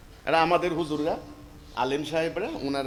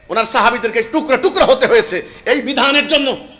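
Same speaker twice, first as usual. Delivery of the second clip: much higher and louder, fervent preaching; impassioned preaching; 9 semitones higher, 10 dB louder than usual. Acted. Preacher recounting the Prophet's sacrifices, fervent loud preaching. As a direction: cry it out with holy fervour.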